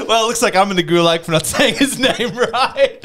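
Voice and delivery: laughing at himself. Real, not acted. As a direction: laugh through the words. Laughs at own mistake.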